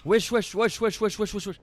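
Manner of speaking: high, loud panicked yells; panicked yelling. Real, not acted. Player startled by a dark mass, yelling in panic. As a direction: yell in alarm.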